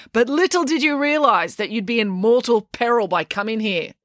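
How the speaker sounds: high, ominous proclamation; melodramatic warning. Acted. April warning tourists of mortal peril, melodramatic. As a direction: warn them with grand drama.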